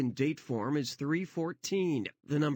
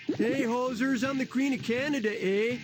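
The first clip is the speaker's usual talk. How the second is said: put-on Canadian accent; 7 semitones higher, 3 dB louder than usual. Queen voiced in Canadian accent.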